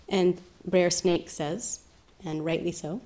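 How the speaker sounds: usual speaking voice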